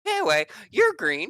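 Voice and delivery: high, loud, eager scientist; bubbly, high-pitched outburst; excited blurt. Acted. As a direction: snap out of the sulk into shrieky enthusiasm. Scientist instantly brightens, blurts it.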